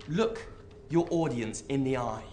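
ordinary talk

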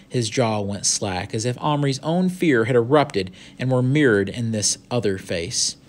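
animated storybook reading, lively pitch; story narration. A narrator's reading. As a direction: read the adventure out with expression. Reads the story aloud.

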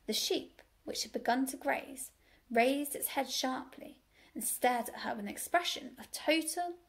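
plain reading voice

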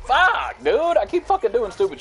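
very high, loud outburst; frustrated shout. Real, not acted. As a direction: yell it out after dying, pitch shooting up. Shouts after dying in game.

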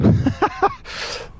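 airy high-pitched giggle; high squeaky laugh. Real, not acted. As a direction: let a squeak of a laugh escape. Laughing at his own mistake.

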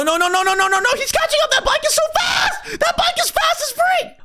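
high panicked shout; being chased. Real, not acted. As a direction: cry out as it gains on you. Bike catching up.

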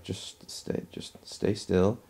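near-whispered imitation of a caution; hushed retold warning. Otway holds out a torch toward wolves' eyes, hushed warning. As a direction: quote it very softly and carefully, slowing at the end.

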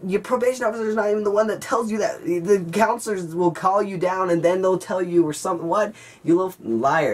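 high-pitched argumentative rebuttal; indignant challenge. Retold or imitated. Teen calling out the bully's excuse, indignant. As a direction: argue back in disbelief, voice pushed up.

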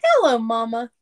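ordinary delivery, nothing marked

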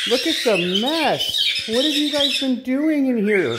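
high, slow playful scolding. Real, not acted. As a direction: tease the birds sweetly.

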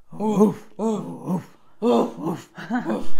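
put-on woof like an elderly dog; grandpa dog. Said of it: "how about a grandpa dog?".

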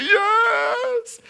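very high, stretched sobbing yes; crying little girl. Retold or imitated. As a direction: imitate a child bawling. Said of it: "The little girl went".

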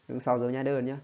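ordinary talk

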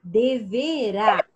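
"deverá" drawn out slowly, syllable by syllable; syllable demonstration. Shown as a demonstration. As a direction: sound out "deverá" slowly for the class. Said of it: "nós circulamos urá".

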